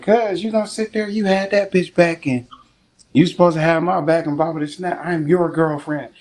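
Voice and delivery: high-pitched scolding; imitated indignant girlfriend. Girlfriend scolds in retold quarrel.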